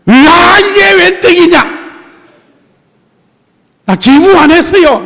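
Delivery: high, loud overjoyed cry of luck; gleeful delight. Retold or imitated. A gift recipient shouting in glee at the windfall. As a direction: squeal over a windfall.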